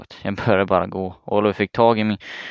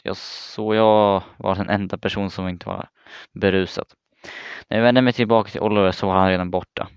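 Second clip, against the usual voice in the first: plain reading voice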